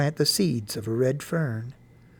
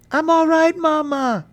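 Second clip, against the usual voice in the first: high, loud call back; shouted reply; 12 semitones higher, 5 dB louder than usual. A narrator's reading. Boy reassuring his frightened mother from afar, shouting. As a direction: holler the answer across a distance. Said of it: "I shouted".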